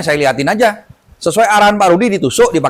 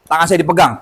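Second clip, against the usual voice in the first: normal talking voice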